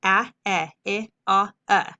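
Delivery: teacher modeling the short vowels; short vowel sounds. Shown as a demonstration. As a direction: run through the short vowels one by one. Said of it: "make the short vowel sound".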